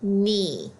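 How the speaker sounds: "Knee" said as "nee", silent K; model word. Shown as a demonstration. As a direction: clear model read of "Knee". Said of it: "Read it again with me".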